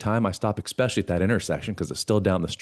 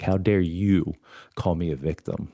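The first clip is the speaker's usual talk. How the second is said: ordinary delivery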